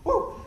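short, high, loud "woo"; real burst of joyful energy; excited whoop. Real, not acted. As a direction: let out a sudden whoop.

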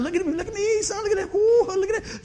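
high, giddy show-off call. Retold or imitated. Dad showing off rollerblading to his son, high giddy bragging. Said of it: "I'm coming around there looking at".

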